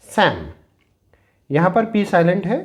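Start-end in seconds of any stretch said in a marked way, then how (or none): 0.1-0.5 सैम said as sam; silent p and l demo. Shown as a demonstration. Teacher showing students how to say सैम. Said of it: "इसे हम पढ़ते हैं सैम".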